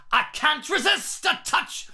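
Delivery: can't and touch with hard clear T sounds; crisp T's. Retold or imitated. Coach showing Halford's crisp T diction. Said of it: "He really pronounces his T's".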